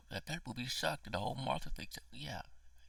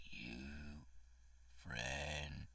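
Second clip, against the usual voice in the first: a lightly voiced alien murmuring; 5 semitones lower, 5 dB quieter than usual. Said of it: "paraphrases himself from that Michael Jackson song".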